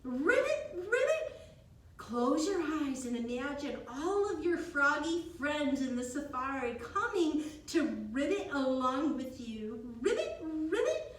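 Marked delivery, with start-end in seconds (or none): 0.0-1.4 high, louder playful frog croaks; frog ribbit. Pretend. Teacher croaks like frog for kids. 10.0-11.2 high, cartoonish frog ribbits. Teacher voices frog friends.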